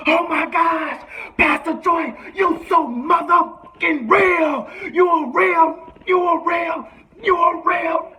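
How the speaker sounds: high, loud, slow fan screaming; ecstatic fan shout. Retold or imitated. [name] screaming in awe at [name]. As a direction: scream your love at your hero. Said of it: "Rich goes".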